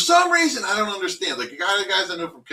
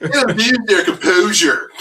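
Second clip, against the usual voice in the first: louder mock Delco accent, echoing the phrase; imitating Delco accent; 3 semitones higher, 7 dB louder than usual. Echoing a friend's phrase in a mock Delco accent. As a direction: parrot his words back in a thick Delco accent. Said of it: "You Delco, when you just said that".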